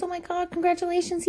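gushing congratulations. Retold or imitated. Repeated gushing.

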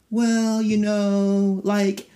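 mock a snooty podcast host; snotty podcaster voice. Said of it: "they're all like".

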